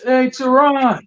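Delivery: retold high-pitched call to a friend. Kevin hailing a colleague walking back from lunch, calling out. Someone calls out to him.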